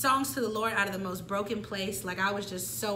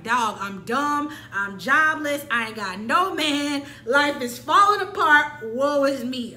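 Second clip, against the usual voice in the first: slow, louder, hammed-up woe-is-me lament; mock self-pity; 3 semitones higher, 6 dB louder, slower than usual. After failing the insurance test, hammed-up self-pity. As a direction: drag out each complaint dramatically.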